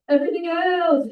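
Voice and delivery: spooky voice. Pretend. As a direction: moan it like a ghost. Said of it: "say everything else in a spooky voice".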